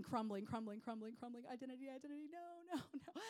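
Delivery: rising in pitch while fading; mock despair, dying away; acted falling apart. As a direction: crumble into a small high whimper. Acting out identity falling apart.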